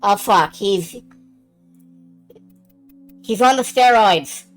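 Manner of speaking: usual speaking voice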